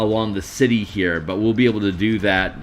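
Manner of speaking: usual speaking voice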